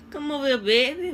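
mimicked New Orleans accent. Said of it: "Something about".

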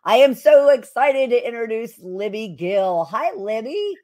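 big, upbeat guest introduction; energetic, enthusiastic. Real, not acted. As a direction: welcome the guest with real enthusiasm. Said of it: "say it with so much energy and enthusiasm".

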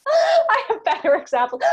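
laughing through the words, high and loud. Real, not acted. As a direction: giggle it out, embarrassed. Laughs at her bad example.

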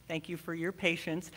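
normal talking voice

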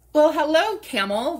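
loud, high, delighted greeting; light up and greet the card; excited hello. Real, not acted.

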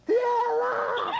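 high, loud "¡Tierra!" like a sailor on the mast; a hammed-up sighting of land; mock lookout shout. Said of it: "fue el que gritó".

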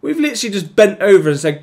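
high, loud, fast; angry rant. Real, not acted. Gamer ranting at his team's surrender, angry. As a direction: spit it out in disgust. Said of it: "I'm just getting annoyed now".

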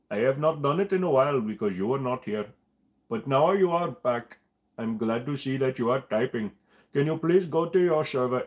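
mock Indian-accented scammer sweet-talking the viewer; Rajesh impression. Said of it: "Rajesh is one of the only ones I can do".

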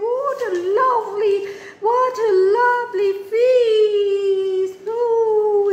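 high, singsong delight; delighted character voice. Acted. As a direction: coo it high, stretched with pleasure. Voicing delight at the food.

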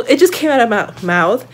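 usual speaking voice

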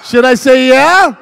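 rousing shout, stepping up; building catchphrase. Shown as a demonstration. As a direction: shout it to work up a crowd. Teasing build-up to his catchphrase.

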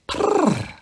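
high rolled purr; a short, high prrr; cat purr. A narrator's reading. Cat purring again.

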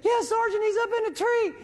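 put-on high excited young soldier voice; Floyd calling out. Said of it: "He's like".

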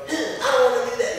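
high, whiny mock protest; child's tantrum. Teacher imitating a kid in his class, mock tantrum. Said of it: "a kid has a tantrum in my class".

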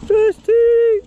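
high shouted call; excited shout. Real, not acted. Skater calling the first trick, shouting high. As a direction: call it out loud to start the run.

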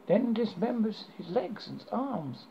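ordinary delivery

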